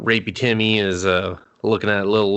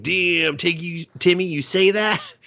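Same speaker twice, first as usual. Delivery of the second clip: shocked; 6 semitones higher than usual. Retold or imitated. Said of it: "I was like".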